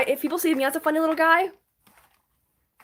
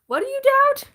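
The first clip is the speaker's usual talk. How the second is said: high squeaky question; 12 semitones higher, 5 dB louder than usual. Real, not acted. Sudden pitch jump.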